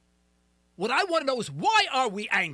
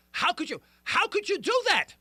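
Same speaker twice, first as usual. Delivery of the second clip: mock disbelief, pitched higher; incredulous challenge; 6 semitones higher than usual. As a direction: imitate a baffled listener demanding an explanation. Voices listeners' disbelief at him.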